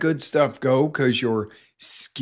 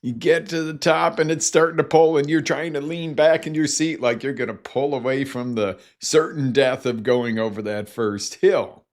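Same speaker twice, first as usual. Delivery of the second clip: normal talking voice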